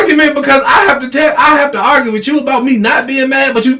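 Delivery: high-pitched, worked-up protest; exasperated arguing. Retold or imitated. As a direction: argue your case as if nobody listens. Acts out the argument.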